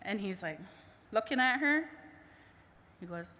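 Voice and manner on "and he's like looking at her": ordinary delivery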